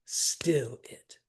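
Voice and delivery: whispered "Still it" with "still" stressed; hushed trochee, "still" first. Shown as a demonstration. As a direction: start strong on "still", then drop "it". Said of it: "it would start with a stress".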